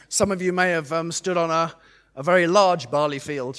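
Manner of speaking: ordinary delivery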